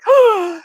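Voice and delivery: high, loud mock gasp; hammed-up gasp of disbelief; shocked gasp. Applicant told she will not be hired, shocked gasp.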